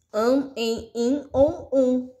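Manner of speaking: "an, em, in, on, um" each vowel voiced through the nose; nasal vowels "an, em, in, on, um" for the class; nasal vowel demo. Shown as a demonstration. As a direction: sound out the nasal vowels one by one. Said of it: "a gente usa ali o nariz".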